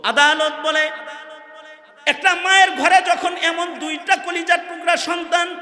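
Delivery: high, loud exclamatory sermon; impassioned preaching. Acted. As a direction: lament to the congregation at full force. Preaching at full force.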